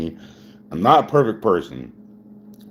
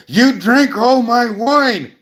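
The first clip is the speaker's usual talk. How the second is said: high, loud, drawn-out mock mom outcry; hammed-up impression of an outraged mother; imitating angry mom; 7 dB louder, slower than usual. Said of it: "my mom's like".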